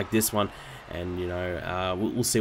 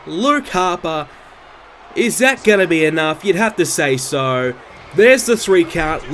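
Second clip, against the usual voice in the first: loud, higher, hyped ringside shouting; excited commentator call; 8 semitones higher, 7 dB louder than usual. Acted. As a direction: yell the finish like a wrestling announcer. Big clothesline, pinfall.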